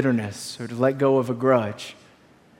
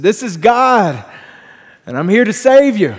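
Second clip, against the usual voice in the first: raised, deliberate voice from on high; God; 5 semitones higher, 5 dB louder than usual. Acted. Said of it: "And the voice said".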